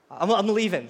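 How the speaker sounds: ordinary talk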